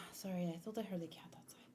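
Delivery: quick hushed whisper, no voice; breathy quick whispered aside. Real, not acted. Aside to chat about noise.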